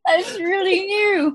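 can't get the words out for laughing; real giggling fit through the words. Real, not acted. Players cracking up at table.

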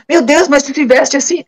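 a hammed-up gasp of disbelief; mock shock. Friend's shocked reply retold.

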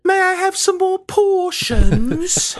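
put-on very high, slow pleading child; Oliver Twist. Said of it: "little Oliver Twist".

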